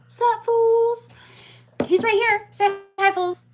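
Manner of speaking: high, loud call to the chat; loud hype greeting. Real, not acted. Streamer calling out to chat and friends, loud and hyped. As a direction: shout it out to the whole chat.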